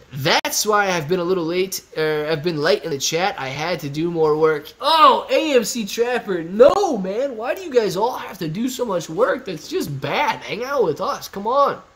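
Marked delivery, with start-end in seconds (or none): 4.8-11.8 tag protest. Real, not acted. Playfully protesting a viewer working late.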